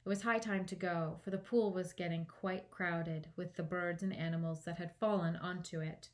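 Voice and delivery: ordinary delivery, nothing marked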